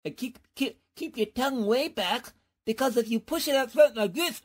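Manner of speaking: ordinary delivery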